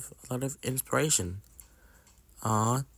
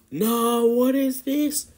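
much higher, louder, slow horrified cry; shocked cry; 12 semitones higher, 5 dB louder, slower than usual. Acted. Player discovering something awful, high shocked cry. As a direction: gasp it out in disbelief.

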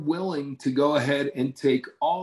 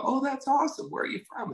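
much higher, bright mock delight; mock delighted reply; 12 semitones higher, 5 dB quieter, faster than usual. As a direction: act pleased to meet her. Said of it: "I go".

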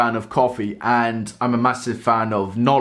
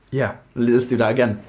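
ordinary delivery

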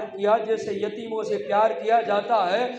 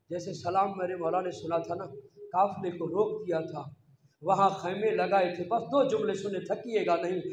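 normal talking voice

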